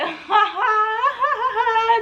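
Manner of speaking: long, high acted-out giggle; imitated laugh. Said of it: "I'm looking at him like".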